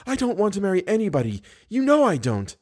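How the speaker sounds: high, quick protest; protesting girl. Acted. Girl denies wanting to marry, protesting. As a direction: rush it out in a high female voice.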